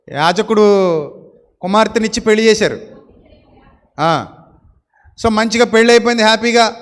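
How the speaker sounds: ordinary talk, nothing marked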